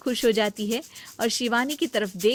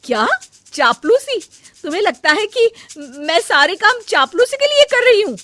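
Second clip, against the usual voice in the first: much higher, much louder indignant shout; outraged; 12 semitones higher, 11 dB louder than usual. Acted. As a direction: explode at the insult. Outraged at being called flatterer. (चापलूसी सुनकर भड़ककर चिल्लाना)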